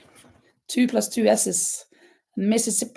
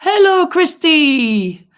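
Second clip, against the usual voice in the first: drawn-out greeting; 8 semitones higher, 10 dB louder, slower than usual. Real, not acted. Greeting a viewer on livestream.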